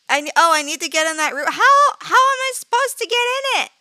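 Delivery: loud, rising to a high frantic cry; urgent, frightened yelling; panicked. Real, not acted. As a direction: build into helpless panic. Panicking about reaching the room.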